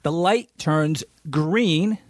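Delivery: normal talking voice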